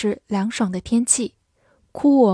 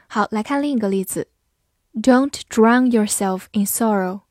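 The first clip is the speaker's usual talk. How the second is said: usual speaking voice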